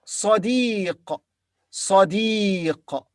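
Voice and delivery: Sadiq, ending on a throaty q; Arabic sadiq. Shown as a demonstration. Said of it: "teacher models the word".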